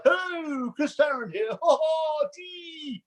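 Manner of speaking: high, drawn-out catchphrase caricature; mock an overblown presenter impression, pitched high; hammed-up radio impressionist. Presenter mimics bad impressionists doing him, hammed-up high caricature. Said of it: "he doesn't do all that".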